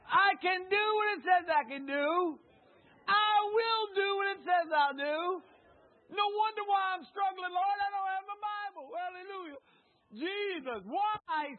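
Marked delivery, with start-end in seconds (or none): 0.1-8.1 high, loud, shouted preaching; fiery preacher shouting declarations; preaching at full force. Acted. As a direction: proclaim it to the rafters. Shouted preaching, congregation call.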